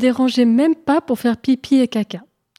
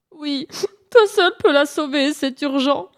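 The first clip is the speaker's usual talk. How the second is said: high, whimpering plea; tearful; 6 semitones higher than usual. Acted. Hare tells Pan only he can save her, tearful. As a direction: beg urgently in a weepy hare voice. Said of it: "en pleurant encore plus fort".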